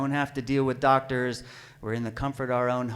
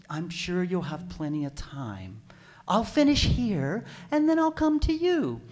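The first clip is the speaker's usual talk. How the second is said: slow singsong midwife, climbing higher; unhurried midwife; 4 semitones higher than usual. Retold or imitated. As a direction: stretch it out, ever higher, mock reassuring.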